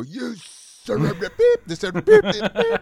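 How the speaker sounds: high, loud hammed-up cursing with beeps; exaggerated outburst rising to very high beeps, ending in laughter; mock road rage. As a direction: rant like a cartoon driver, self-censoring in squeals. Mock road rage with censored swearing.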